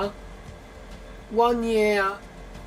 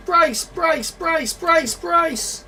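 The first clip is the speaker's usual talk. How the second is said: crew shouting brace; 7 semitones higher, much slower than usual. Real, not acted. Crash landing, calling brace.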